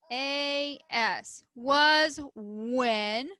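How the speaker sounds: usual speaking voice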